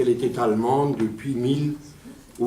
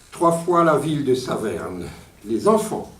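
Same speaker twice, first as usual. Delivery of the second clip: ordinary talk, nothing marked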